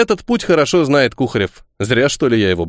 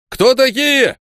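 a high, raised challenge; calling out; 12 semitones higher, slower than usual. Acted. Guard at the barricade calling to the riders, raised challenge. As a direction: call out sharply across the barricade. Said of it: "окликнули из-за баррикады".

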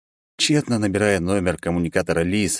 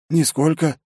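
feigned surprise; 9 semitones higher than usual. Acted. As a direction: act surprised convincingly. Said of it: "Шестирукий отлично разыграл удивление".

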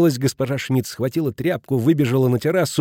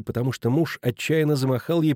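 usual speaking voice

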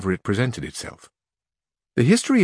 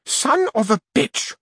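a much higher, slow, outraged curse; angry outburst; slower than usual. Acted. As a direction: spit the insult at him. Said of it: "all aglow with anger".

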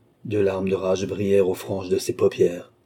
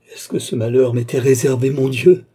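higher, louder anguished appeal; crying out; 5 semitones higher, 7 dB louder than usual. A narrator's reading. Young woman appealing to God, crying out in exaltation. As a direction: cry it out, swelling at the end. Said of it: "s'écria la jeune fille".

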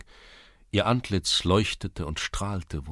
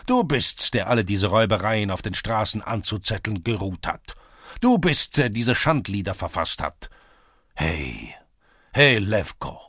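enraged village headman; 3 semitones higher, 5 dB louder than usual. A narrator's reading. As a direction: berate your son, indignation surging. Headman rages at his son. (zornig polternder Dorfschulze)

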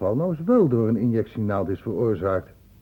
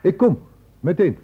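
urgent call; 8 semitones higher, 9 dB louder than usual. Acted. Doctor rushing off urgently.

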